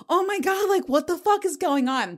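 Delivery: high amazed outburst; shocked disbelief. Retold or imitated. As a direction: sound floored by the situation. Jill shows up with Valium.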